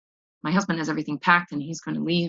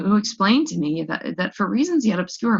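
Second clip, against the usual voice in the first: ordinary delivery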